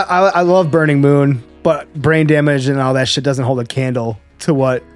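usual speaking voice

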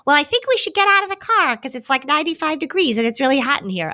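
imitating her child in the back seat, high and quick; child's voice. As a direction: chirp it like a sensible small child. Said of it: "one of them said".

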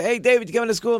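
lightly acted shout across the house; calling out. Siblings rounding up David for school, calling out.

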